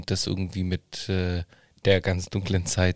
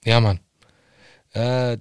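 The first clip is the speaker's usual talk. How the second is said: ordinary delivery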